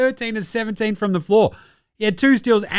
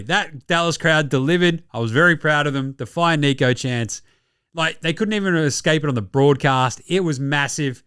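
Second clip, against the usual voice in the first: ordinary delivery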